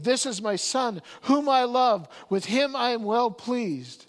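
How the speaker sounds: preacher voicing God's voice from the glory; booming voice of God. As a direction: proclaim it like a voice from heaven.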